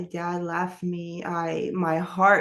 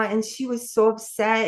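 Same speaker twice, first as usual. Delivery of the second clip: ordinary talk, nothing marked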